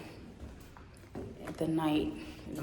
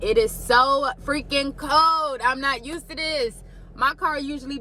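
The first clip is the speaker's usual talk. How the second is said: complaining loudly; 6 semitones higher, 5 dB louder than usual. Real, not acted. Cold outside in the morning.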